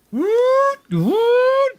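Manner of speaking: high rising siren whoop; imitated alarm klaxon. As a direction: whoop like a breach alarm, twice. Game master imitates breach alarms.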